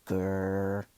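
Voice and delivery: drawn-out, level grrr; wolf growl. A narrator's reading.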